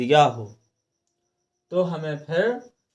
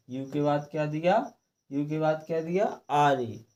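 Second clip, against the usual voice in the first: usual speaking voice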